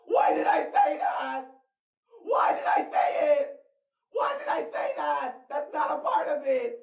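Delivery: high-pitched panicked repetition; freaked out. Real, not acted. Asking why those words came out, freaked out. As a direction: panic rising into a high, strained wail.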